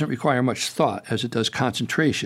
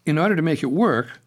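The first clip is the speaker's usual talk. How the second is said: usual speaking voice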